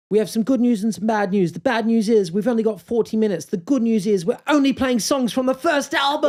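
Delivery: rock-star announcement. Retold or imitated. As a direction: tease the crowd from the stage. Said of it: "the singer, was like".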